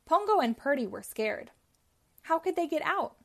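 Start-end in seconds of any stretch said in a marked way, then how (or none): none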